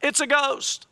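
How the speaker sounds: high, panicked cry of ghost; frightened cry. Acted. Disciples in the storm crying out, mock frightened. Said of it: "they cried out".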